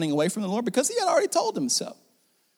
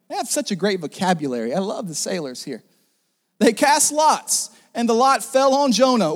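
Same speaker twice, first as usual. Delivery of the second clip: usual speaking voice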